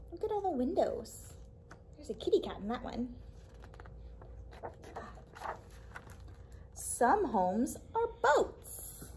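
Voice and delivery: lively, expressive storytime read-aloud; reading to children. Acted. Librarian reading a picture book to kids, lively storytime voice.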